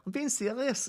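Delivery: high-pitched Scouse impression; Scouse accent. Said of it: "Do a Scouse accent".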